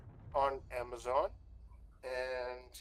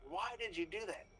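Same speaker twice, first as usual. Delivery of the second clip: self-scolding inner voice; 9 semitones higher than usual. Retold or imitated. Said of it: "think to myself".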